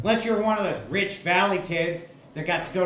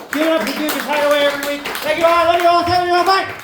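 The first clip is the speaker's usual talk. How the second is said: loud sign-off; 10 semitones higher, 5 dB louder, faster than usual. Real, not acted. Comic's loud sign-off to crowd.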